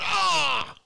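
high, loud startled shriek of disgust; mock shriek. Brother recoiling at the name, high mock shriek. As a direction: shriek like a brother who just heard a hated name. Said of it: "and he went".

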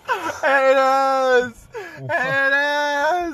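very high, loud drawn-out cry; mock scream. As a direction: wail it out in exaggerated outburst. Mimics the enraged coworker's outburst.

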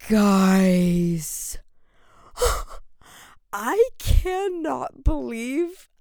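gasping high and loud in disbelief; gasp, then force the words out slowly; shocked gasps. Real, not acted. Sees baby news while recording.

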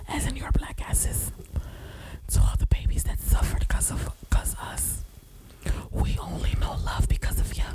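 breathy ASMR whisper, unvoiced. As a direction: whisper close to the mic like an ASMR video. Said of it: "I can't do that A S M R shit".

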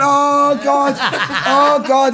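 high, loud mock dismay; hammed-up horrified groan of alarm. Dealer hearing he took twelve bumps, horrified. Owner horrified at the amount taken.

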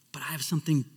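soft, secret whisper; whispered promise. Retold or imitated. Jesus tells the crowd he has something better, whispered. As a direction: lean in and whisper it. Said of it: "he goes".

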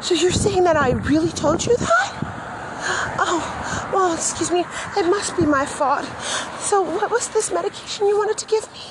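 high, sweet, mock-innocent voice, all politeness; sugary polite voice. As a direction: play the nice lady who never gets angry. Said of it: "not supposed to have any signs of aggression or hostility in my voice".

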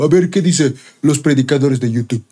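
slightly put-on, idle viewer's voice; lightly mock a casual sermon browser; lightly voiced soulish believer. Soulish believer browsing YouTube preachers, lightly mocked. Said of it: "eres un adorador almático".

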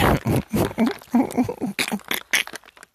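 breathy high laugh; giggling. Real, not acted. Laughing at himself.

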